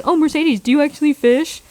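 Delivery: mock asking fan. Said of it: "That's how much people ask".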